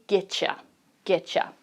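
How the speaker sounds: getcha, quick and reduced. Shown as a demonstration. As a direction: get you said as getcha, the t and y merged into ch. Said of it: "in a lot of American accents".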